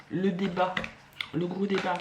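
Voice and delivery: normal talking voice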